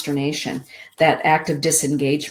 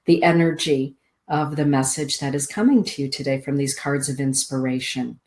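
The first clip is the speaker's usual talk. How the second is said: ordinary talk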